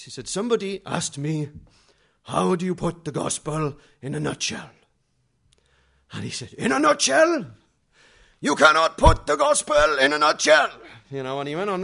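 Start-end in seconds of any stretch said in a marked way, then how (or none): 0.3-7.5 put-on booming German-accented preacher voice, much higher and louder; big German voice. Preacher quoting a question to his crowd, big German voice. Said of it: "in his big, German voice". 8.4-10.7 booming German voice, far higher and louder; big German voice. Retold or imitated. Evangelist telling the crowd the gospel won't fit, booming German. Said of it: "in his big, German voice".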